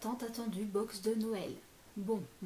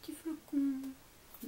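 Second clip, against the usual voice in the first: cooing; 8 semitones higher, much slower than usual. Real, not acted. Finds a cute item.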